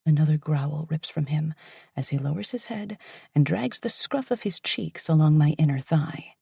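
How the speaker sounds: ordinary delivery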